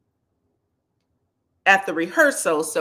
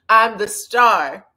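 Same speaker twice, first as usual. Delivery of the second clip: laughing diva boast; 2 semitones higher, 8 dB louder, slower than usual. Retold or imitated. Repeating Toni's boast laughing.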